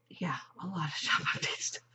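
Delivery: giggly whisper. Pretend. Repeats phrase, then whispers it.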